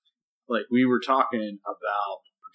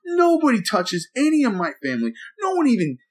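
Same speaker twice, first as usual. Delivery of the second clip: retold heated rant; 6 semitones higher than usual. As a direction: spell out every move, worked up. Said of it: "I'm telling her everything I'm doing".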